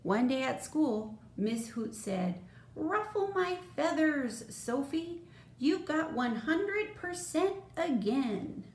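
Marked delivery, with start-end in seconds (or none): 2.7-8.6 high, delighted teacher voice; animated high-pitched storybook schoolteacher; impressed teacher. Acted. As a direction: praise a star pupil in a raised, animated pitch. Teacher praising pupil in story.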